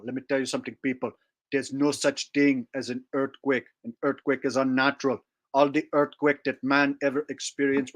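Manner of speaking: put-on preachy accented character voice; mag impression. Preaching to people about Eden and earthquakes, mag impression. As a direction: lecture the crowd with odd accented certainty. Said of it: "I did mag earlier".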